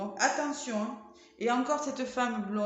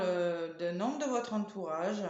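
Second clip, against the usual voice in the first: ordinary talk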